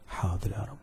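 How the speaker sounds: low, very soft reply with a lift; hushed, humble assent; meek obedience. Acted. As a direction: answer submissively, under your voice. Acted meek reply to God.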